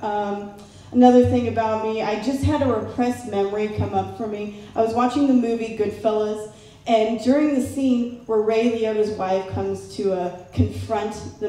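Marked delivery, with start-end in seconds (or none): none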